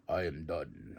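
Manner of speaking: a terse, gruff brush-off; deep Oba voice. Retold or imitated. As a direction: drop into a low, slow giant's voice. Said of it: "He's like".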